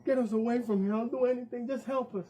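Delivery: mother begging the taxi driver for help; desperate plea. Acted. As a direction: beg for help.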